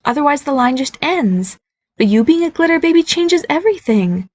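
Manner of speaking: a mother gently explaining family magic; voiced mother. Mother explaining the Fae line.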